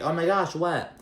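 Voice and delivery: imitating a startled friend; mock surprise. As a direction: play it as shocked gossip. Dorit reacting to surgery news.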